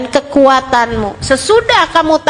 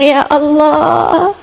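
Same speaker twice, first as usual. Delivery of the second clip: acted plea for mercy, long drawn-out vowels; slow, imploring prayer on a sustained note; tearful supplication; slower than usual. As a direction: plead with God as if weeping. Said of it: "dengan linangan air mata kamu bilang".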